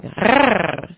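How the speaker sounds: rolled purring growl from the throat; throaty growl. Shown as a demonstration. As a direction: trill in the throat, not the tongue. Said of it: "the [name] growl with your throat".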